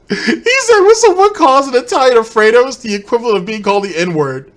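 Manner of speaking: high, loud squeal through real laughter; incredulous laughing retell. Real, not acted. Retelling the commentator's absurd claim, cracking up loudly. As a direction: barely get it out, voice shooting up with laughter.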